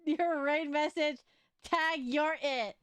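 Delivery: high, slow hammed-up indignation; mock outrage. Real, not acted. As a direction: scold them in fake horror. Mock outrage at chat raider.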